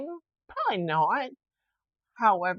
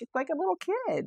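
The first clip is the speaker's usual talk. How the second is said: high-pitched imitation of teasing friends; mock teasing; 12 semitones higher than usual. Friends poking fun at her yawning, imitated teasing. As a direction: tease in a much higher voice, imitating. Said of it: "my friend makes fun of me".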